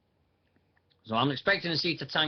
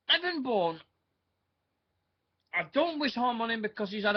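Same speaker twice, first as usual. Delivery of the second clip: outraged rant; 8 semitones higher than usual. Real, not acted. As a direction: rage at him, pitched up high. Ranting about Airboom.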